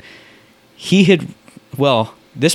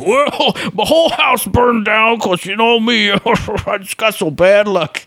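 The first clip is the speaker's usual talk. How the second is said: hammed-up woe-is-me whine, pitched way up; Eric; 10 semitones higher than usual. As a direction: wail it as a silly impression. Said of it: "there would be an awesome Eric impression".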